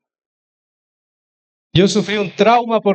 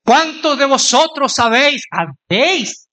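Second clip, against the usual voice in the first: put-on higher, old-fashioned preacher's "vosotros sabéis"; pompous pulpit voice; 6 semitones higher than usual. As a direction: ham up a solemn King James style preacher. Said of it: "con acento Reina Valera sesenta".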